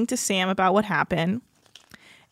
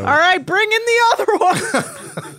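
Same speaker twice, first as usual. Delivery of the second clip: higher, drawn-out pretend summons; mock calling out; 8 semitones higher than usual. Calling in a replacement engineer, mock holler. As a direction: call out slowly to someone off in the next room. Calls in another engineer, playing along.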